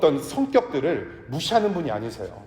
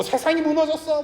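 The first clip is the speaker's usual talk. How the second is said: much higher, hammed-up wail of outrage; mock shrieking mother; 13 semitones higher than usual. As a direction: scream it like the world really ended. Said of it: "엄마가 하빈이한테 그렇게 리뷰할까요?".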